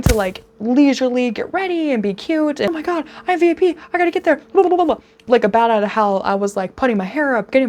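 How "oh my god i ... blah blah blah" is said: high and fast, tumbling out; breathless rushed excitement. Retold or imitated. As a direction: gabble it in a panic. Her own rushed panic retold.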